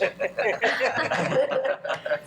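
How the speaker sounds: short amused laugh with rising pitch; light laugh. Real, not acted. Light laugh at her own joke.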